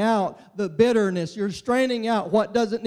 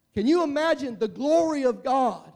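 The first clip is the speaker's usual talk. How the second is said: ordinary talk, nothing marked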